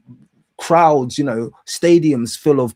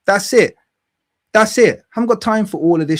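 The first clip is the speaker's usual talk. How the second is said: angry emphatic; 8 semitones higher, 4 dB louder, faster than usual. Real, not acted. Angry rant.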